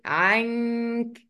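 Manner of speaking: "عنك" with a long held nasal hum for the n; hidden noon with ghunnah. Shown as a demonstration. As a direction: stretch the hidden n of "عنك" through the nose. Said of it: "همده شوية".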